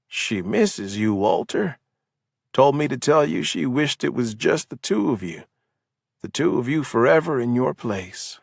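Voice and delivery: lightly voiced drugged man, drifting and eerie. A narrator's reading. Larson passes on Lillian's message.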